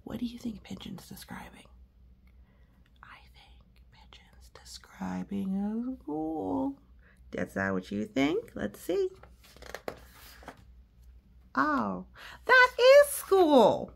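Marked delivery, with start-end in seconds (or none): 0.0-6.8 soft hushed aside, whispered; storyteller whispering to children. Acted. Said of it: "stage whisper to listeners". 11.6-13.9 high, loud, slow realization; surprised Pigeon. Acted.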